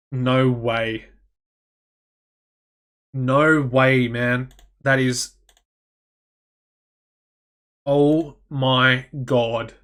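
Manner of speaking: slow, drawn-out disbelief; stunned, each word stretched with space between; in shock. Real, not acted. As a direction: let it sink in, marvelling. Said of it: "in a little bit of shock".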